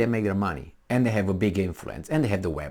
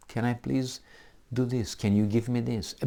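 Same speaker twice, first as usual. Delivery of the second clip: softer, mock-courteous asking; a weasel asking nicely, softer; smooth polite official; 6 dB quieter than usual. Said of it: "These are a little smoother".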